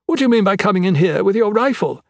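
high-pitched, affronted queen; scandalized royal scolding; indignant exclamation. A narrator's reading. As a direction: demand an explanation, pitched high. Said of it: "the Queen exclaimed".

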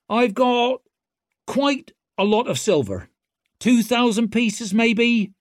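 high, cocky and loud; swaggering rogue taunting; brash young woman. A narrator's reading. Ricker voice.

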